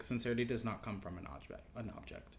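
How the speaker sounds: hushed. Retold or imitated. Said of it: "Jin Hwan's like".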